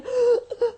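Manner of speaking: high sharp gasp, mimicking; imitated sob gasp. Preacher imitating the crying child's gasp. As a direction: catch breath like a crying child.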